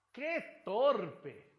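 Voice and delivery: high, loud cry of dismay at oneself; exclaimed. Shown as a demonstration. Teacher demonstrating an exclamation to the class, loud and high. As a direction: burst out with the exclamation. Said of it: "variación en la curva melódica de la voz".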